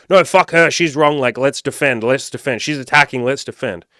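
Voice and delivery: high, loud angry rant of the ego; defensive ego yelling. Retold or imitated. His ego yelling at his partner, angry and loud. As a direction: bark it out like a bruised ego. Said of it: "My ego jumped up and was like".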